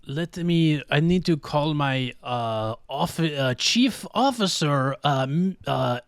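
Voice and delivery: hesitant mock French officer; French accent. Officer needs to call his chief, in French accent. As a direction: fumble for the name in a put-on French accent. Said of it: "we do the French accent".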